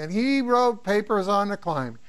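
ordinary delivery

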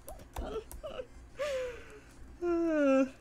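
high-pitched giggle fit; high squealing laugh. Real, not acted. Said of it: "talent you have is class".

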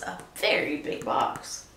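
whisper. A narrator's reading. Storyteller builds suspense.

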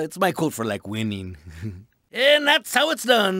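usual speaking voice

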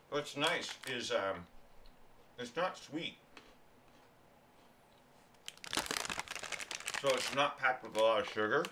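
talking while chewing; words through a mouthful of food; mouth full. Real, not acted. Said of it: "Sorry for talking with my mouth full".

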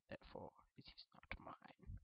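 hushed unvoiced aside; whisper. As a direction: whisper it like a secret.

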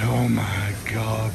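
ordinary talk

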